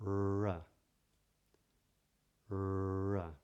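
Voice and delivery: R with tongue curled up, lips slightly pouted, tip off the roof; modern British R. Shown as a demonstration. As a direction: model the British R slowly and clearly. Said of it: "So it goes something like this".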